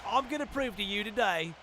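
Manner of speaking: a lightly put-on English accent; British accent. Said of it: "so he's got a U K accent".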